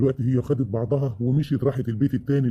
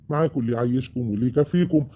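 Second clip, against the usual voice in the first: ordinary talk, nothing marked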